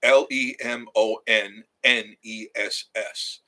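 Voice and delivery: slow, letter by letter; each letter slow and distinct; spelling it out. Shown as a demonstration. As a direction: spell L E M O N N E S S out for the viewers. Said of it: "Folks, go to Lemoness".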